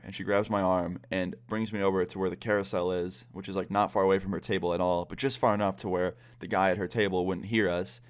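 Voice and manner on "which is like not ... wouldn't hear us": usual speaking voice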